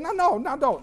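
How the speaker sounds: high, loud mock protest; playful protest. Playful protest to fiancée, retold.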